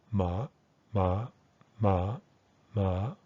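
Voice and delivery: "ma" with a full "ah", tone sliding slightly upward; unreduced pronunciation. Shown as a demonstration. Teacher pronounces the first syllable carefully, "ma" full and rising. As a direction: say each "ma" separately, open vowel, gently rising. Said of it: "according to the unreduced pronunciation".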